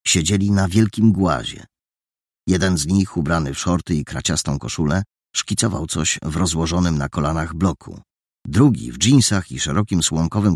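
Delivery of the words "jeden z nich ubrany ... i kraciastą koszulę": plain reading voice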